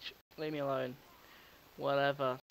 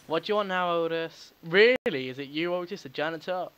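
loud annoyed rant at a pest; exasperated yelling; 3 semitones higher, 10 dB louder than usual. Acted. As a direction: yell at him like he's pestering you again. Annoyed at caller Otis.

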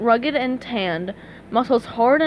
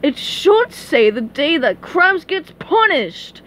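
slow, higher theatrical villain; Plankton gloating; 5 semitones higher, 4 dB louder, slower than usual. A narrator's reading. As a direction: gloat grandly, stretching the words. Plankton gloats over Krabs.

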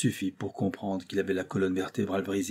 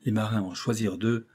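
plain reading voice

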